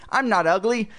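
high squeaky dummy voice; squeaking ventriloquist's dummy; Slappy, squeaking. A narrator's reading. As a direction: protest in a high puppet squeak. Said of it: "in Lindsay's squeaking voice".

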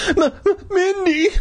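very high, stuttering weak moan. A narrator's reading. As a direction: stammer the name as if half-strangled. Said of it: "I moaned".

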